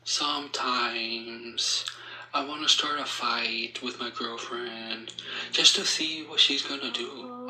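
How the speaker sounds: ordinary delivery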